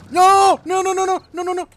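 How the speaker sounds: high, loud rapid-fire no's; frantic high-pitched panic; panicked. Real, not acted. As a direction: blurt a string of high, alarmed no's. Panics after hitting wrong key.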